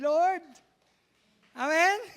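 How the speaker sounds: loud high call; fiery preacher's call for amen; preaching shout. Real, not acted. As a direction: shout it out to the church.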